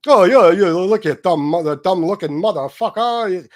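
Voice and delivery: loud, weird mocking imitation with a New York accent; mock his strange robot-like voice loudly; goofy robotic voice. Streamer ridiculing a rival's strange voice, loud goofy impression. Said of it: "He talks like he's a robot".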